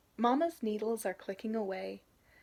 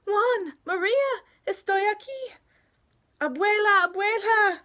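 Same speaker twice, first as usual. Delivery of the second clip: calling out; 10 semitones higher, 11 dB louder than usual. Acted. Family calling across border fence.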